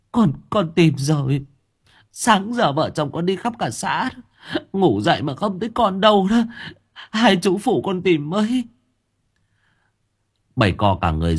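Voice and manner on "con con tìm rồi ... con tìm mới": a desperate mother begging for help, voice crumpling; whimpering plea. Acted. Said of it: "Con Cúc mếu máo".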